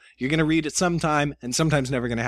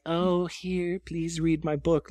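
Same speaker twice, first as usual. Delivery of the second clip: soft, slow, begging little voice, mocking the pushy author; mock pleading; 3 semitones higher, 4 dB quieter, much slower than usual. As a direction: plead softly and slowly, making fun of it.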